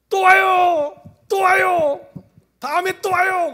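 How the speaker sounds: very high, loud calling out; retold joyful calling. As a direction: call after the leaving choir. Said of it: "그러는데".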